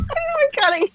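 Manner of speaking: high shocked squeal. Real, not acted. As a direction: squeal in disbelief.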